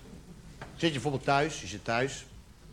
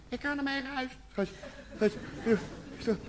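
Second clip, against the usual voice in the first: put-on upset girlfriend; 2 semitones higher, 13 dB quieter than usual. Girlfriend's tearful reply, acted.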